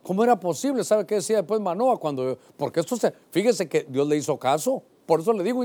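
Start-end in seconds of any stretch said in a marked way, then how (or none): none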